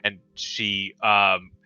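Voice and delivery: ordinary delivery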